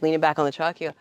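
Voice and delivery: ordinary talk, nothing marked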